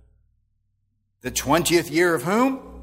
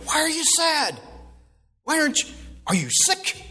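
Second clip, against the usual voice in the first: demanding king; 13 semitones higher than usual. Retold or imitated. King voiced demanding in retold scene.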